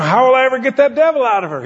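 high, loud exasperated plea; pleading husband. Retold or imitated. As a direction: beg the preacher for help. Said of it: "And he says".

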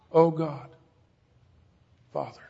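low, fading plea to the Father; acted agony, barely voiced; hushed anguished prayer. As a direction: pray softly, letting the last word sink. Acts Jesus praying in agony.

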